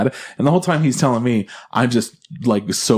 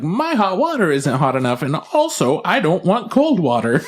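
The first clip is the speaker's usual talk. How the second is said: put-on high whiny old lady voice; mock old lady complaint; 7 semitones higher than usual. Predicting the tenant's next call, high and whiny. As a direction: whine like a fussy old tenant. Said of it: "she's gonna call back and be like".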